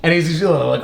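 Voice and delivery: ordinary talk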